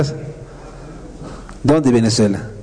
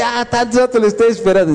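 impatient lady; 9 semitones higher than usual. Retold or imitated. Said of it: "Me dice".

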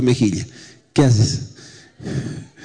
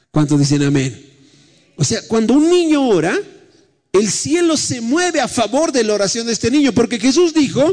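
lively preacher to congregation; preaching; 5 semitones higher than usual. Acted. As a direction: preach with rising fervour. Preacher sermon delivery.

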